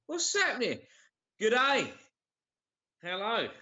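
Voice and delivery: high, hammed-up Aussie "good day"; mock Australian greeting. Greeting a viewer from Sydney in a mock Australian accent. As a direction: camp it up like a broad Australian.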